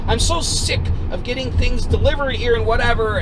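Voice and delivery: high, loud imitation of exasperation; fed-up rant. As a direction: rant like an irate homeowner. Fed-up homeowner rants in quarrel.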